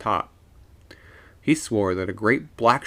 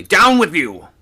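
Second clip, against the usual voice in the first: loud, higher urgent shout; urgent order; 9 semitones higher, 12 dB louder than usual. A narrator's reading. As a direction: bark it up the rigging. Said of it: "speaking rapidly".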